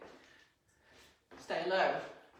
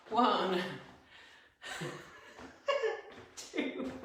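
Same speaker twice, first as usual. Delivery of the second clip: laughing; 3 semitones higher than usual. Real, not acted. Counting a rep, breaks up laughing.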